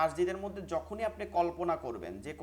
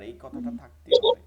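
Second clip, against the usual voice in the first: louder and higher, pressing the point home; emphatic insistence; 9 semitones higher, 12 dB louder than usual. Real, not acted. Teacher insists the class must not leave this out, emphatic. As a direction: insist so no one misses it again. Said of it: "সবাই এটা miss করতেছেন".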